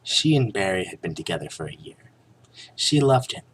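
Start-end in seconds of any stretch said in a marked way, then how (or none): 2.8-3.4 hammy stage actor. A narrator's reading. Melodramatic narration.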